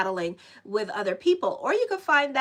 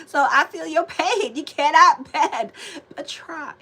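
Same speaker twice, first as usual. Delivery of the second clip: louder, talking through laughter; laughing; 4 semitones higher, 7 dB louder than usual. Real, not acted. Still laughing as she tells viewers she feels their pain.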